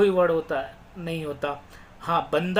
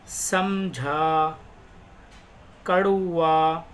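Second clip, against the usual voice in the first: each word read out slowly and clearly, with pauses; teacher dictating vocabulary; slow word-list reading; much slower than usual. Shown as a demonstration. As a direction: read the words one by one like a teacher.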